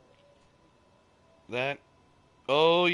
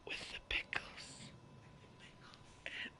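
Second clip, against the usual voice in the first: whispered, slow; hushed drive-thru request; 12 dB quieter than usual. Acted. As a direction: breathe it secretly. Drive-thru order bit.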